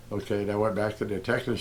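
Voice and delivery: ordinary delivery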